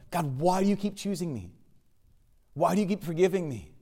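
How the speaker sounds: imitated earnest plea; retold pleading prayer. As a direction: plead with God, without raising the volume.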